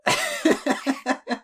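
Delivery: talking through laughter; laughing. Real, not acted. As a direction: giggle the words out. GM laughing at prayer mime.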